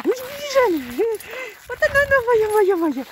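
high-pitched loud pet talk; high excited cheering. Real, not acted. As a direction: squeal with delight at her.

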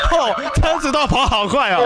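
laughing. Real, not acted. Teasing the scammer, laughing.

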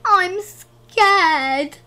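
put-on loud, high, scared little boy; frightened cry. A narrator's reading. Little boy in the shelter as bombs land, frightened cry. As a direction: cry out in fear.

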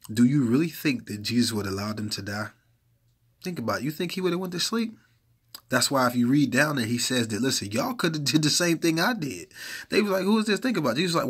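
rising, loud preaching with mock dialogue; preaching at full force. Preacher working up the congregation, loud fervent preaching.

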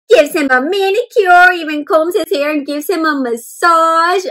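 put-on lively read-aloud voice for young listeners; picture-book narration; story reading. A narrator's reading. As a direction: read it out bright for the class.

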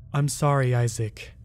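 plain reading voice

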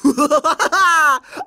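loud high mocking laugh; villain laugh. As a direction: laugh down at the hero. Vampire boss laughs at hero.

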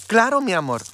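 high, tender reply to the nephew; tender reassurance. Retold or imitated. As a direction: reassure him lovingly. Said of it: "con mucha ternura, mucho amor".

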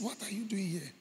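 unvoiced, hushed question; whispered question. Man whispering to his dead brothers, hushed. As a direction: breathe the question.

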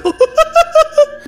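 long high-pitched giggle; high laugh. Real, not acted. Laughs at the stuck animal.

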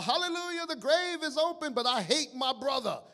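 high, loud jubilant church shout; imitating a congregation's loud hallelujah cry; mock praise shout. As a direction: shout it like an exultant worshipper. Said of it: "we're gonna shout".

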